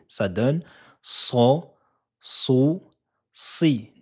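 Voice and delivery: so, so, si with a heavy emphatic S and a faint hiss; teacher demonstrating the emphatic sad syllables. Shown as a demonstration. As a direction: puff out the back of the tongue for so. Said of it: "La lettre Sod se prononce".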